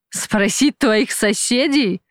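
understated villainous ridicule, without an actual laugh; scoffing amusement. A narrator's reading. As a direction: throw the question back, drawn out and amused. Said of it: "рассмеялся босс".

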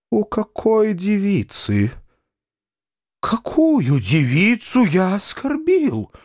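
slow, high-pitched bewilderment; bewildered. A narrator's reading. Foma forgets whom he insulted, bewildered. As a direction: draw out each question as if utterly lost. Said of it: "в недоумении".

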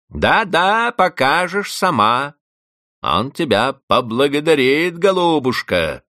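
venomous sarcasm. A narrator's reading. Housekeeper taunting crying Vanda, sarcastic. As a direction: taunt her sweetly. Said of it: "язвительно говорила Анна Григорьевна".

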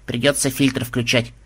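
understated preoccupied grumble; worried grumble. A narrator's reading. Granddaughter must switch on a filter, worried grumble. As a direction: grumble it with mild concern. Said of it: "озабоченно проворчала внучка".

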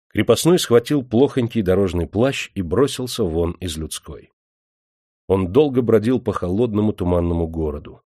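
usual speaking voice

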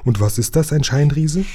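hopeful question lifting high at the end; hoffnungsvolles Quieken. A narrator's reading. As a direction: ask with a touch of eager squeak. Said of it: "quiekte Nepomuk so hoffnungsvoll". (hoffnungsvoll fragend, am Ende hoch)

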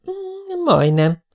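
high, hesitant hum, then a small admission; könyörgő. A narrator's reading. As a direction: admit it sheepishly, pleading. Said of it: "nézett az anyjára könyörgően Dénes". (könyörgő, bizonytalan, magas hang)

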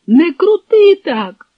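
high, loud yelp of protest; crying out. A narrator's reading. Fugitive protests as the Cossack grips his arm, crying out. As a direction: yell it slowly, pitch jumping up. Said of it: "закричав той".

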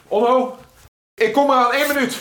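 calling out. Acted. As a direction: shout it through to someone at the door. Calls out to visitor outside. (hard en hoog roepen naar buiten)

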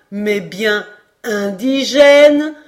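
"indigène" loud and very drawn out; emphatic reveal. Acted. Geographer correcting Glenarvan's reading, "indigène" slow and loud. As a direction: proclaim "indigène" slowly as the answer.

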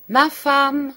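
loud high call across the house. A narrator's reading. Charles calling his wife from below, loud and high. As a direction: shout for her from downstairs. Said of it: "cria Charles".

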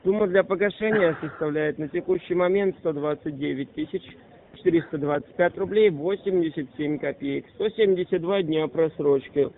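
ordinary talk, nothing marked

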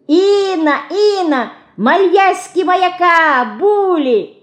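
put-on very high, loud toddler shout; piping baby-talk call; Марьяшка. A narrator's reading. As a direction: yell like a tiny child with babyish pronunciation. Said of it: "кричит Марьяшка".

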